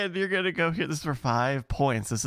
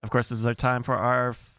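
usual speaking voice

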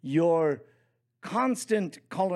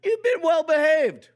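parent voice; 13 semitones higher, 6 dB louder than usual. Retold or imitated. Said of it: "what I would tell my children".

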